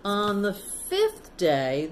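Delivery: ordinary delivery, nothing marked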